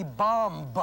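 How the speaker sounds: BOMB ending in an extra "buh"; sounded final B. Shown as a demonstration. Teacher showing what not to say, BOMB with sounded B. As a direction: pronounce the silent B at the end of BOMB. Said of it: "You do not pronounce the last B".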